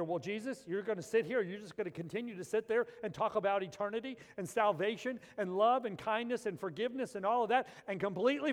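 loud, high exasperated complaint; impatient Martha. Retold or imitated. Martha scolding Jesus over lunch, loud and exasperated. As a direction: nag Jesus to get moving.

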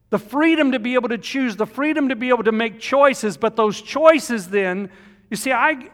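loud pulpit preaching; full-force preaching. Acted. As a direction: preach it to the back pews. Opening of the sermon, preached loud.

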